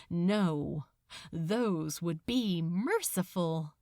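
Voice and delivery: plain reading voice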